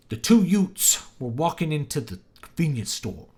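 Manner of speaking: put-on mob-movie New York wiseguy accent; Joe Pesci. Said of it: "Joe Pesci has this New York accent".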